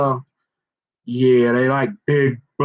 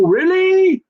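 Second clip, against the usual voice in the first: mock surprise; 11 semitones higher, slower than usual. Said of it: "and you go".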